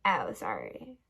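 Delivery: low, soft mock apology; put-on low voice for sorry; mocking how people talk. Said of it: "make fun of how people talk".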